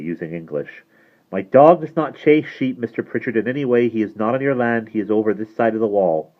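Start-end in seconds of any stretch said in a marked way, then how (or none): 1.3-6.3 higher, firm and pointed rebuttal; clear, pointed. Acted. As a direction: state it clearly, standing your ground. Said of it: "Bran said clearly, pointedly".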